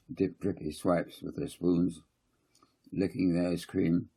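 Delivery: ordinary delivery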